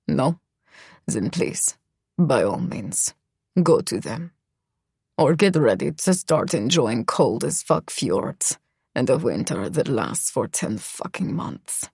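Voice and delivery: Misha, low and cold, almost murmured; dropped low, a menacing murmur; low male voice. Acted.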